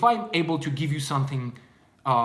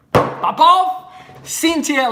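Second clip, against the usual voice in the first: high, loud shout-out; calling out names; 12 semitones higher, 10 dB louder, much slower than usual. Real, not acted. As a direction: holler names to the crowd.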